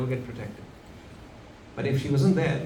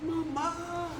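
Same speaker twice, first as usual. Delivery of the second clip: put-on child's cry for mother; 6 dB quieter than usual. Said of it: "Krishna said".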